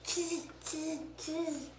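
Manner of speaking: light chattering monkey voice. Acted. Monkeys taunting the peddler, lightly voiced chatter. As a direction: mimic cheeky monkeys chattering back.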